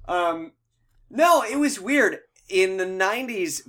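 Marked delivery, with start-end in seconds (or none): none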